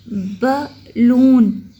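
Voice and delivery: बलून very slow and loud, split ब, लून; teacher's loud, drawn-out ब ... लून in बलून; two syllables. Shown as a demonstration. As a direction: stretch बलून into two separated beats. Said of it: "two syllable word है".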